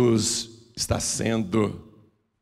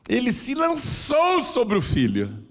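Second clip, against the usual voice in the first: much higher, thrilled telling; excited high narration; 13 semitones higher than usual. Real, not acted. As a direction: paint the leap with a soaring voice.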